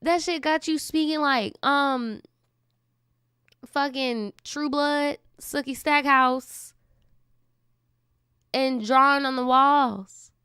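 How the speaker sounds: high, slow, scoffing disbelief; mock it with wide-eyed disbelief; high, slow, scoffing at the story; incredulous mockery. Real, not acted. Mocking the tale.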